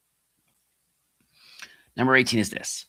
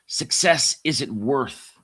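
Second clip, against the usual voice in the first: ordinary delivery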